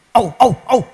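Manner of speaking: loud yapping au au au; dog barking. Retold or imitated. Preacher mimicking the neighbour's dog barking, loud yaps. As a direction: yap like a dog at the door. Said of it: "Ada suara anjingnya".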